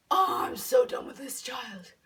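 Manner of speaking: slow, drawn-out exasperated groan; hammed-up fed-up complaint. Running away from Jane down the alley, exasperated.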